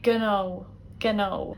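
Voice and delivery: gno said /gnoʊ/ with the g and n both sounded; gno, keep the hard g before n; Munster pronunciation. Shown as a demonstration. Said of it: "Whereas in Munster, they would say".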